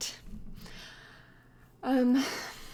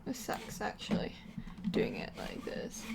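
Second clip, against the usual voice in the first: whispered aside; 7 dB quieter than usual. Real, not acted. Quiet aside while counting.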